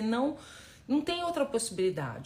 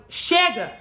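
battle cry shout; 8 semitones higher, 10 dB louder than usual. Shown as a demonstration. Coach urging viewers to say enough, a loud battle cry. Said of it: "Dá um grito de guerra aí".

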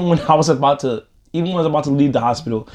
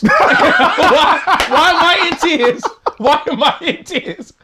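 much higher, slow laughing repeat; laughing disbelief; 11 semitones higher, 3 dB louder than usual. Real, not acted. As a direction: laugh through the memory of the pain. Retelling painful tube removal, laughing.

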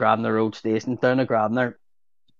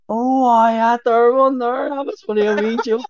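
sheepish mock confession; 10 semitones higher, 5 dB louder than usual. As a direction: play it off as a harmless joke. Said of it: "I just turned around and went".

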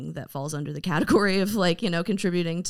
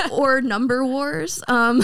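laughing; 12 semitones higher, 5 dB louder, slower than usual. Real, not acted. Laughing at own idea.

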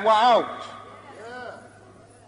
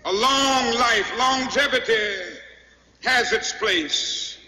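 measured sermon building in fervour; fervent preaching. Real, not acted. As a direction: testify to the congregation, stretching key words. Preaches to a responding congregation.

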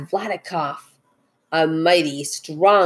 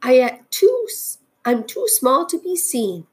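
small character calling up, higher; lightly pitched-up little voice; tiny voice calling; 6 semitones higher than usual. A narrator's reading. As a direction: call up as a tiny person. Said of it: "called the voice".

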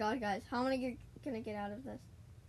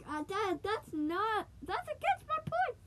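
flustered squeak; 9 semitones higher than usual. Real, not acted. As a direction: squeak out the embarrassing realization. Realizes he contradicted himself.

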